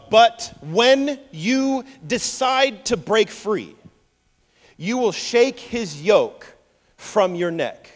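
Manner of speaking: slow, emphatic scripture proclamation; preaching declamation. Acted. Isaac prophesying freedom to Esau, slow and declaimed. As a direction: declare it deliberately, with long weighted words.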